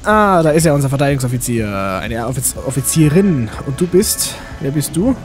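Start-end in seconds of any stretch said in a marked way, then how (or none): none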